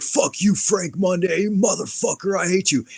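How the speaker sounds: high-pitched imitation of a hostile rant; mock drunken anger. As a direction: act out an attacker cursing his target. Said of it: "he's, like, half drunk or on drugs".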